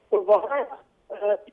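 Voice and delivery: choppy line cutting out; breaking up space radio. Real, not acted. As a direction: voice dropping out on a bad connection. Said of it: "आपकी आवाज़ थोड़ी सी cut रही है".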